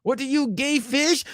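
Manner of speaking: high-pitched mock Mexican accent. Comedian springs the punchline on [name], mock Mexican accent. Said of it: "his own Mexican accent".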